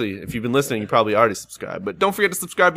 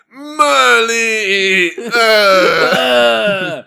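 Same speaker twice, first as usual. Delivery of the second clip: louder, higher sobbing call; hammed-up teary call; 8 semitones higher, 7 dB louder, much slower than usual. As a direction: wail the dog's name. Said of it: "mock wail".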